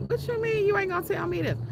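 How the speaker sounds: the father's indignant demand; outraged father. Retold or imitated. Father demanding to know his daughter's secret, outraged. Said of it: "He was like".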